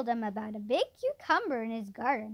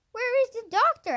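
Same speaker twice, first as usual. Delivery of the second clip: cross demand, higher and louder; crossly; 11 semitones higher, 10 dB louder than usual. A narrator's reading. Man crossly asking for the doctor, crossly. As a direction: ask it loudly, a touch cross. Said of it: "asked the man crossly".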